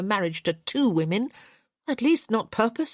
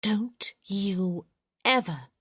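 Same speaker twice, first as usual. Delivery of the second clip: low controlled fury; 3 semitones higher, slower than usual. A narrator's reading. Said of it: "her voice low and clipped and terribly controlled".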